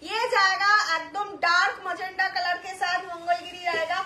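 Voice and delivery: ordinary talk, nothing marked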